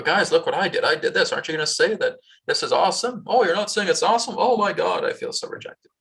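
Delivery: high whiny plea for praise, ending in a mock whisper; needy approval seeker. Person needing approval whines for praise. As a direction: beg for praise like a hurt child.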